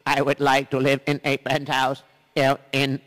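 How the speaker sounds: loud, slow, stilted alien voice; Martian visitor. Pretend. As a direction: speak like a robotic spaceman. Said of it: "somebody from outer space".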